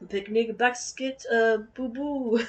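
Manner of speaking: slow, lightly voiced cartoon bear; mock cartoon bear impression; slow playful cartoon bear bit; Yogi Bear. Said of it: "sound like Yogi Bear".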